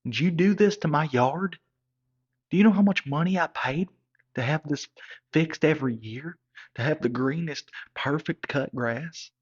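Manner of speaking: he's furious about his ruined lawn; angry dad. Retold or imitated. Father confronts the boyfriend who tore up his lawn, loud and outraged. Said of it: "he said".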